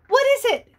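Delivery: high, loud excited cry; joyful shout. Acted. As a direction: burst out with joy. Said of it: "the bunny shouted for joy".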